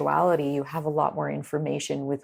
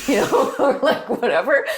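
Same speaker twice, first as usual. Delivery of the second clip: higher and louder, laughing through the words; 8 semitones higher, 7 dB louder than usual. Real, not acted. Joking about a Winnipeg film, laughing through the words. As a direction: giggle the words out at your own joke.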